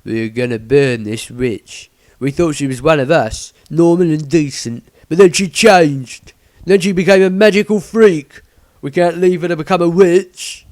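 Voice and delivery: loud, rough villager, rising into a rant; a zealot justifying the burning; loud righteous mob man; angry villager. Acted. Villager voiced in a story.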